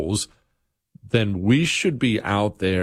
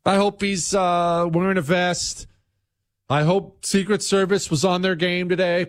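sarcastic, higher fake concern; hammed-up hand-wringing; mock worry; 7 semitones higher than usual. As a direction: sneer through fake sympathy. Mocking worried opponents after shooting.

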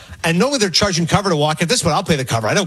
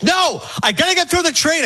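much higher, indignant mock refusal; retold incensed commuter; 9 semitones higher than usual. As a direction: imitate an agitated man who won't be turned away. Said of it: "a guy's incensed".

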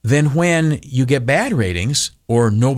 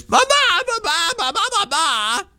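much higher, cartoonish ba-ba babble; mock boss babble. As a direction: mimic the boss as pure noise. Boss comes in and goes.

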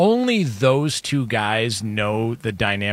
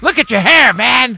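mock dad sneer; 10 semitones higher, 8 dB louder than usual.

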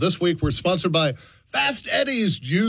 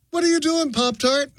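incredulous; 7 semitones higher than usual. Acted. Scolding a Pop-Tart.

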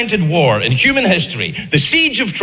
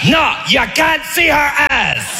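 mock French Trojan taunting; 4 semitones higher, slower than usual. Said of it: "were like".

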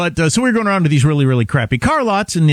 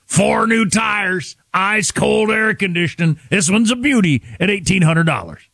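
higher, stretched local used-car pitch; gravelly car-ad guy; 6 semitones higher than usual. Retold or imitated. Host recalling a dealer's radio commercials, imitated gravelly pitch. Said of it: "this guy with this gravelly voice".

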